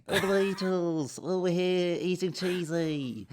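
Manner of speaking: lightly put-on Liverpool accent. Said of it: "you slip in and out of a Beatles thing".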